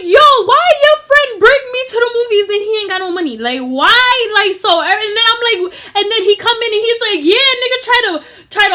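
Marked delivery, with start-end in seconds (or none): 0.0-4.3 higher, louder indignant question; loud complaint. Retold or imitated. Confronting friends loudly. 7.2-8.7 high, loud mock anger; angry. Imitating angry guy.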